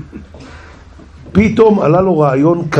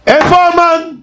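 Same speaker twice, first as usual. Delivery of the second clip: much higher, louder impatient cry; angry demand; 14 semitones higher, 3 dB louder, slower than usual. Acted. The nervous king demanding Haman, high and angry. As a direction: demand it in a fury. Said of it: "מה זה עצבני".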